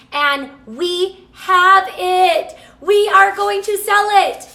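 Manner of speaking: rising to a high, loud burst of joy; can't hold back the thrill; super excited. Real, not acted. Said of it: "Sorry, I get super excited".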